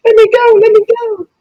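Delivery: very high squeaky rat voice. Acted.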